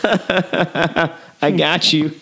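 slow laughing "got you"; laughing gotcha. Retold or imitated. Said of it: "play a joke on everyone".

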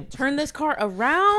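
hammed-up dad threat; mock stern parent. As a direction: play the fed-up parent. Joking stern parent line.

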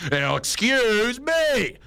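slow, drawn-out high sarcastic "excuse me"; mock aggressive driver. Shown as a demonstration. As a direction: stretch "excuse me" out as a pointed rebuke. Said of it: "one that's more like".

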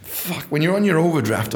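ordinary talk

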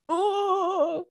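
high, drawn-out mock wail of "oh"; mock venting groan. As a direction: let out a long, high exasperated "oh". Imitates venting in phone message.

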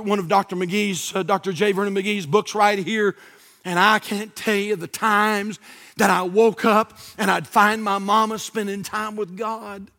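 ordinary talk, nothing marked

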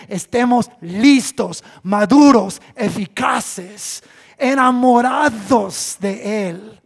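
loud, slow, emphatic build; preaching climax. Real, not acted. Pastor exhorting the church, loud and slow. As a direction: drive each word home to the congregation.

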